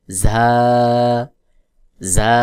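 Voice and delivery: ঝা said "zaa", long and wide open; open-mouthed za. Shown as a demonstration. Teacher drilling after the correction, ঝা as open "zaa". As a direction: drop the jaw and hold ঝা as "zaa". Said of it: "মুখ খুলে বলতে হবে".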